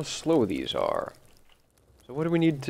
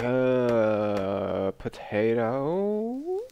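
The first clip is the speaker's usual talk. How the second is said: groggy brain; 3 semitones higher, much slower than usual. Acted. Gamer voicing their not-yet-awake brain, slow dopey groggy voice. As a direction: play a sleepy brain, slow and stretched. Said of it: "my brain's not quite awake yet".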